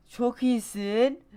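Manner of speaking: high, loud, slow bitter sarcasm; sarcastic praise. Real, not acted. Gamer mocking himself after the fall, sarcastic. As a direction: drag it out mockingly.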